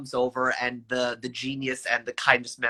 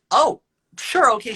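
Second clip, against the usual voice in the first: higher, louder startled "oh, sure"; surprised; 8 semitones higher, 5 dB louder than usual. Retold or imitated. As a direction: caught off guard, covering it up. Deflated after being told off.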